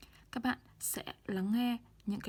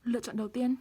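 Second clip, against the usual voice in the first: ordinary talk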